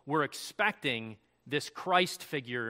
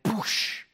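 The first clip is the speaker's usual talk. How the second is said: whooshing "boosh" like a crash; imitated impact boom; 5 semitones higher than usual. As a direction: make the sound of a meteorite hitting. Imitates meteorite hitting still water.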